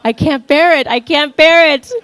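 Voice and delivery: loud, high hammed-up wail; mock despair. Speaker lamenting she is a religious leftist, mock despair.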